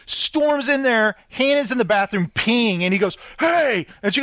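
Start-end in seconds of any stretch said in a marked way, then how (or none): 3.4-3.9 high mock-angry call; angry confrontation. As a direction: burst in on her, imitating his outrage. He storms into the bathroom.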